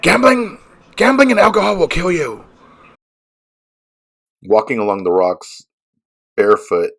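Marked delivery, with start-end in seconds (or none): 0.0-2.4 high, loud warning mom voice; shrill nagging mom. Retold or imitated. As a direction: scold like a worried mother. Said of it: "my mom's voice was there".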